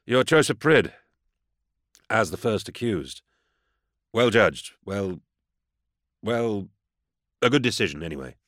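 halting praise, with gaps; grudging compliment. A narrator's reading. Inquisitor feeling he must say something, awkward compliment. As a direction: grope for something polite to say. Forced compliment, groping for words.

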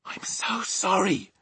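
slow, hushed whisper; apologetic whisper. A narrator's reading. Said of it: "Sophie whispered".

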